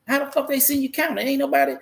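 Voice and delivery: indignant disbelief. Retold or imitated. Retelling his anger over stolen money.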